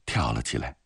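ordinary delivery

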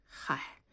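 soft, breathed-out sigh; breathy little sigh of "hai". A narrator's reading. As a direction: let out a quiet, resigned sigh. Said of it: "文丽叹了口气说".

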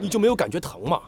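ordinary talk

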